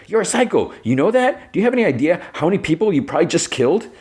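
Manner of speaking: high, fast, outraged telling-off; mock yelling. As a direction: imitate a furious yell without the volume. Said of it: "Carol yells at him".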